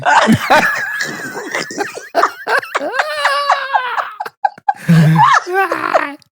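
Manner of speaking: long, high-pitched laughing fit; real, helpless high laugh; burst of laughter. Real, not acted. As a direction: crack up at a surprise joke. Joke about the name's meaning.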